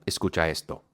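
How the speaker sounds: ordinary delivery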